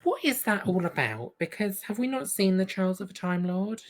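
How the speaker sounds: usual speaking voice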